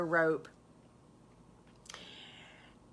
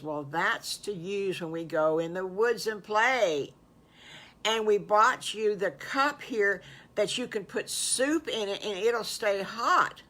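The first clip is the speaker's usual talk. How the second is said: raised, louder storybook pig voice; eager explaining Piggly; 3 semitones higher, 5 dB louder than usual. Acted. Piggly shows wolf his gifts, eagerly. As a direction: present each gift with lively emphasis.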